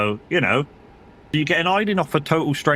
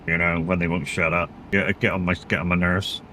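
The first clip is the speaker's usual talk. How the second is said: normal talking voice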